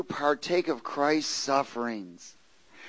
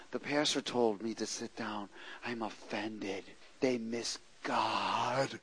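sulky mutter; 7 semitones lower, 12 dB quieter, slower than usual. Retold or imitated. Offended churchgoer muttering.

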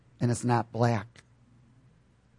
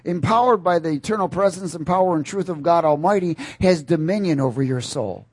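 raised, emphatic preacher's delivery; forceful preaching; 5 semitones higher, 3 dB louder than usual. Real, not acted. Preacher telling congregation the spirit man is empowered, forceful preaching. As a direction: drive each phrase home to the congregation.

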